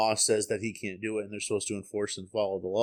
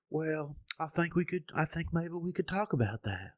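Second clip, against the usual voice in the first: put-on southern accent; 7 semitones higher than usual. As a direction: mimic him with a high southern twang. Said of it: "a little bit of a southern accent".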